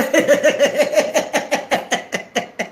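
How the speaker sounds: delighted staccato cackle, trailing on; drawn-out giggling fit; long rippling laugh. Real, not acted. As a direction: laugh helplessly in quick pulses. Laughs after singing praise.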